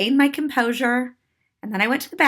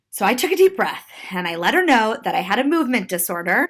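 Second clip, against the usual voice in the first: plain reading voice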